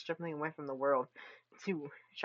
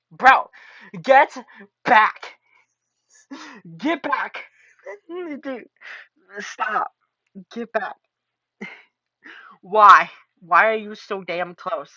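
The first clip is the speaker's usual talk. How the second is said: much louder, higher shouted warning; loud yell; 4 semitones higher, 19 dB louder, much slower than usual. Acted. Streamer yelling at a teacher to back away, loud and alarmed. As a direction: shove him off with your voice.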